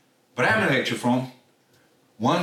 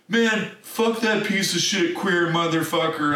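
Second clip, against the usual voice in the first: slow, raised-pitch mock contempt; 7 semitones higher than usual. Redneck's insult in retold fight.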